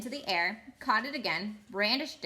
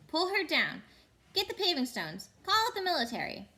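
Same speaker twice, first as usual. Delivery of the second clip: angry crowd roar; 7 semitones higher than usual. Acted. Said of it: "an angry roar from the crowd".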